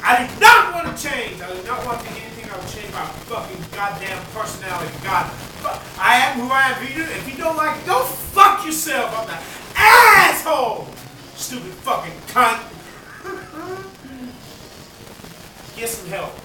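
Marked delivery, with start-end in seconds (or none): 0.0-1.4 loud high shout, stress on not; angry refusal. Real, not acted. Refusing to change. 6.0-10.9 loud, high, slowing defiant yell; defiant shout. Real, not acted. Defiant outburst. 12.7-15.7 high airy laugh under the breath; breathy laugh. Real, not acted. Laughing after insult.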